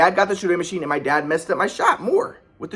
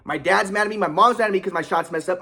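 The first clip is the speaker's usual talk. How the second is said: ordinary talk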